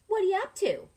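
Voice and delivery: loud, high, chirpy question; Miss Delphine asking. Acted. Teacher voicing diner owner asking the child her plans. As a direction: beam at the kid as she asks. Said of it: "I tell her".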